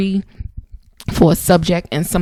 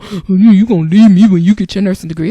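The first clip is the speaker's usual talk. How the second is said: mocking whiny partner; 2 semitones higher, 8 dB louder, faster than usual. Mocking sulking jealous partner.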